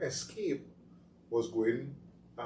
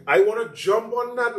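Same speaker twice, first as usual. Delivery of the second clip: mock eager politician; 9 semitones higher, 6 dB louder than usual.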